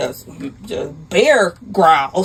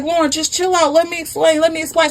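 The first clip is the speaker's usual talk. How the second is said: higher-pitched pleading; acted pleading; 7 semitones higher, faster than usual. Husband begging his furious wife to let him explain, pleading. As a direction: plead urgently to be heard.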